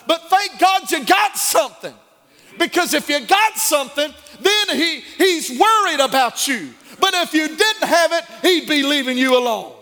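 loud, high preaching climax; rising preacher's fire; preaching at full force. Acted. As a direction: lift the church with it, shouting.

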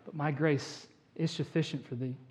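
soft answer after an outburst; hushed voice of God; calm divine reply. Acted. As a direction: drop to a quiet, steady reassurance. God calmly answers the protest.

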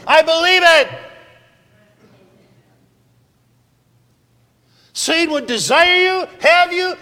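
loud, high, full-force pulpit preaching; fiery preaching. Acted. Preacher rousing his congregation, shouting at full force. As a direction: thunder it out to the congregation, building to a roar. Call-and-response preaching climax.

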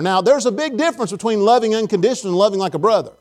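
usual speaking voice

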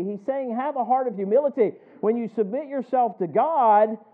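loud, rising pulpit cadence; preaching at full force. Acted. Pastor warning the congregation about Satan, loud preaching. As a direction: drive it home to the congregation.

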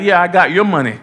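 put-on cheeky little girl claiming dad's money; daughter's cheeky reply. Preacher mimicking his daughter's cheeky reply. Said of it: "she's like".

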